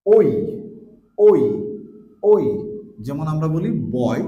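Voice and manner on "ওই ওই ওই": ওই said loud, o gliding into i; diphthong demo. Shown as a demonstration. Teacher drilling ওই for students, loud and clear. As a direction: sound ওই as one glide from o to i. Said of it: "next one".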